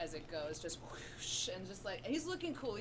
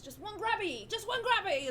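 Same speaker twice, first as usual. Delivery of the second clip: begging urchin; 11 semitones higher, 6 dB louder than usual. Acted.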